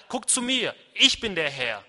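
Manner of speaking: emphatic wake-up call from God. Acted. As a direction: call for attention, then assert authority. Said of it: "Manchmal muss er lauter werden".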